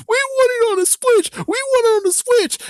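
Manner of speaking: very high, whiny chant, mocking; mocking whiny fans. Mocking whiny fans who want it on the Switch, very high whine. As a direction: squeal it like a spoiled kid demanding a toy. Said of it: "whiny bastards saying".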